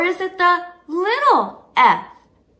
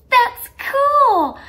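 delighted gasp; 8 semitones higher, 4 dB louder than usual. Real, not acted. Seeing the child's find.

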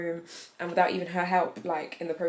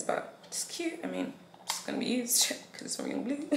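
hushed; 7 semitones higher, 8 dB quieter than usual. Real, not acted. Whispering delight over gift.

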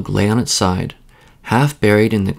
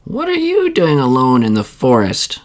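put-on old woman's question; 4 semitones higher than usual. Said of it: "asked the old woman".

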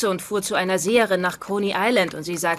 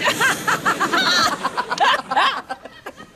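high laugh rising at the end; 10 semitones higher than usual. Real, not acted. Wife laughing at her husband's jealousy, high laugh. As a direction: keep laughing at the joke.

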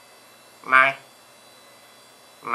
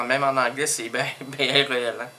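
usual speaking voice

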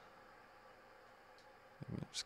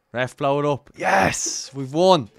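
loud, higher shouting at the final whistle; celebrating win; 5 semitones higher, 9 dB louder than usual. Real, not acted. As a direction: yell it out, the match is won. Final whistle, team wins.

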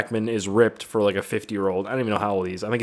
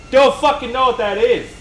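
exasperated yell; 13 semitones higher, 6 dB louder than usual. Retold or imitated. Retelling an argument with an error message, exasperated yell.

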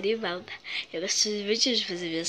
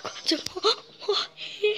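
sobbing; 8 semitones higher, much slower than usual. Acted. Child sobbing after scolding.

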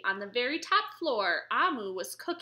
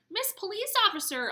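exclaimed; 8 semitones higher than usual. Acted. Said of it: "Amu exclaimed".

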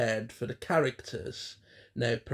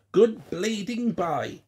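high, slow, loud puppet curse; cursing drunken tramp; 8 semitones higher, 5 dB louder than usual. A narrator's reading. Tramp puppet ends his stream of hate, loud cursing. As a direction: spit out a drawn-out farewell. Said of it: "spitting curses and obscenities".

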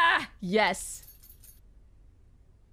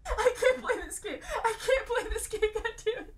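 normal talking voice

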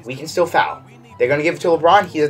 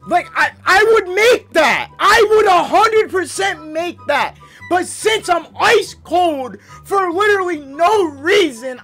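raging gamer; 7 semitones higher, slower than usual. Real, not acted. Ranting at the game.

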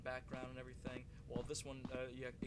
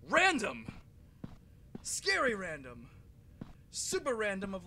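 loud, higher rattled rant; 6 semitones higher, 12 dB louder, slower than usual. Real, not acted. Gamer ranting about the random scare, rattled. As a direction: spit it out still shaken.

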